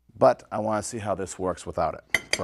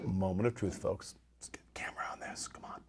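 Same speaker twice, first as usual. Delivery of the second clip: whispered suspense before the first bite; hushed whisper; 2 semitones lower, 11 dB quieter, faster than usual. Real, not acted. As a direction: draw the audience in with a whisper. Hushed build-up before tasting.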